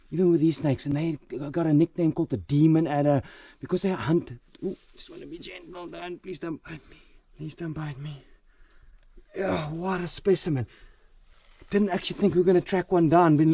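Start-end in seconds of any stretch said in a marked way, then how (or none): 4.6-8.2 quiet pleading. Real, not acted. Pleading while handling the snake.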